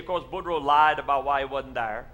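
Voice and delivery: normal talking voice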